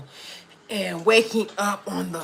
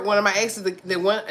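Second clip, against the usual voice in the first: usual speaking voice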